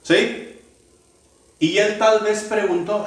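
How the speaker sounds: ordinary talk, nothing marked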